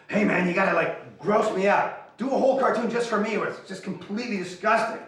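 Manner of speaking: high, loud, pleading enthusiasm; eager fan impression. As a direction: demand it like an overexcited young fan. Acts out eager fan letters.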